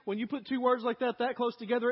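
ordinary talk, nothing marked

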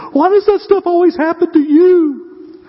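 high, slow, incredulous church member voice; mock puzzled churchgoer. Church members asking the pastor, in high, slow, mock disbelief. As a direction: play the baffled congregant asking why.

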